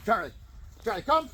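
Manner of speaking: loud, high recall call; calling the dog. Real, not acted. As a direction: shout him in happily.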